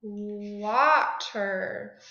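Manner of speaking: "Water" low with long open first vowel; vowel switch demo. Shown as a demonstration. Teacher changes waiter's vowel for class, low drawn-out "Water". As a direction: stretch the ah in "Water", low and falling. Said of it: "that A to an ah".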